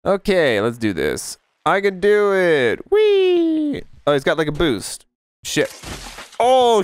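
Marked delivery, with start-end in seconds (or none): 2.9-3.8 high, drawn-out whee; gleeful whee. Real, not acted. Gamer whooping as he launches off. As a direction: ride it like a slide. Joyful ride yell.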